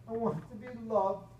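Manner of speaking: a touch of tearful pleading; hammed-up tearful dragon; sobbing. A narrator's reading. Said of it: "sobbed the dragon".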